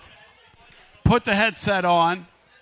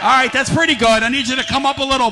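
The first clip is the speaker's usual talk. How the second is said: raised and louder, rallying; loud hype; 5 semitones higher, 4 dB louder, faster than usual. Real, not acted. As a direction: push the crowd for more. Urging crowd to be louder.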